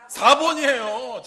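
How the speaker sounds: slow loud answer; emphatic loud reveal. Real, not acted. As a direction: proclaim the answer slowly.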